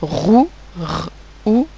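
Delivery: "Roux" whole, then r and ou apart; sounding out. Shown as a demonstration. Teacher breaking a syllable down for pupils, sounding out. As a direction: split Roux into a rolled-out r and a separate ou. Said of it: "à la fin de ma syllabe".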